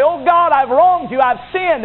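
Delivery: high, urgent cry to God; pleading confession. Acted. Debtor coming to God to confess, high and urgent.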